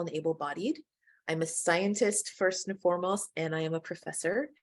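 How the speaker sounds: usual speaking voice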